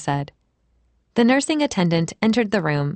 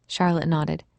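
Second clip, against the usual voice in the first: ordinary delivery, nothing marked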